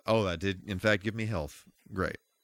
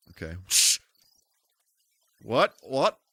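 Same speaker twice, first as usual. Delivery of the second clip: ordinary talk, nothing marked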